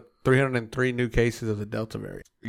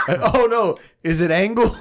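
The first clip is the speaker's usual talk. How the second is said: laughing mock; 9 semitones higher, 7 dB louder than usual. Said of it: "that's what you said".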